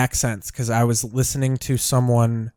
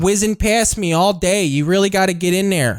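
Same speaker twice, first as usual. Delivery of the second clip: mock Chicago airport worker; 5 semitones higher than usual. Continues the Chicago worker bit.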